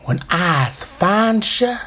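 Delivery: slow, drawn-out menace; mock threat. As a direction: stretch each phrase into a hammy warning.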